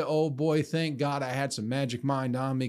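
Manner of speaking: usual speaking voice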